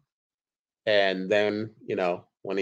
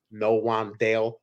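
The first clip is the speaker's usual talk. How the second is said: usual speaking voice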